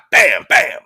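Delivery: two punchy spoken gunshot noises; imitated gunshots. Son shoots his dad, imitated gunshots. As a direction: fire off two shots with the voice.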